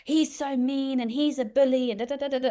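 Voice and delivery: a hint of a sulky little girl complaining. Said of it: "in her little girl way".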